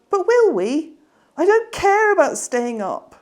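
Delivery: put-on high, loud, eager child asking; Petey begs his sister about bedtime, high child voice; little boy voice. Petey presses again.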